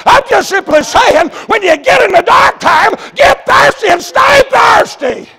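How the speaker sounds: high preaching shout, slowing at the end; shouted exhortation. Real, not acted. Preacher urging congregation through dark times, shouted exhortation. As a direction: cry it out and stretch the last words.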